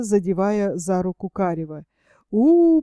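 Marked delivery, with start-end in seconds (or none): 2.3-2.8 a much higher, loud У! ringing out; echoing shout. A narrator's reading. Shout echoing through the fresh forest, loud and high. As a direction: let the У! roll through the woods. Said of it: "прокатилась гарка по освежённому лесу".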